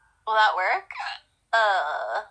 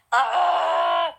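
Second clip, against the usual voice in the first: sudden sharp hiccup, high and loud; a real hiccup bursting out; 6 semitones higher, 4 dB louder than usual. Real, not acted. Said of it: "I have the hiccups".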